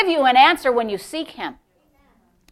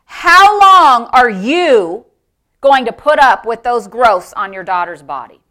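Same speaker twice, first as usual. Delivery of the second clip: stern, measured pronouncement; loud and clear; 5 dB louder than usual. Retold or imitated. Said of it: "Loud and clear".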